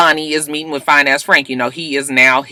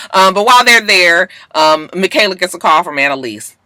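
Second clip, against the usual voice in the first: ordinary delivery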